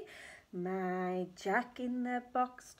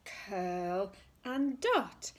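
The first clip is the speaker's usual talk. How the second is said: slow tracing; much slower than usual. Shown as a demonstration. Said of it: "down his body".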